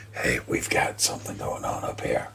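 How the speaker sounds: hushed whisper to companions; quiet, secretive whisper; whispered warning. Acted. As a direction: whisper it low to the group. Player quietly alerts the party in character.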